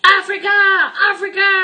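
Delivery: high, loud cries of Africa; excited screamed announcement; screaming. A narrator's reading. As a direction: scream it out to wake sleeping passengers. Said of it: "awakened by Zigomar's screams".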